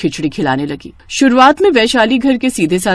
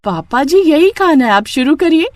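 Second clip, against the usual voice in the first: cheerful bride; 7 semitones higher than usual. Acted. Bride insists snacks are food.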